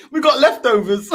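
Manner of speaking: laughing, loud. Real, not acted. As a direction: laugh while you say it.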